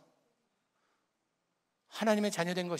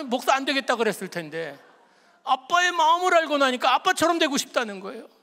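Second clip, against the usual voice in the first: higher and louder, voice lifting with emotion; moved and excited; 6 semitones higher, 7 dB louder than usual. Real, not acted. Father telling the congregation his son's wish, overjoyed. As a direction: let the joy break into the line.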